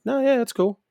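lightly put-on high-pitched reply; breezy brush-off. Retold casual reply to bartender.

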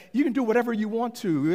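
high-pitched fake permission; mock agreeable, clearly put out; passive-aggressive. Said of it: "passive aggressively, I said".

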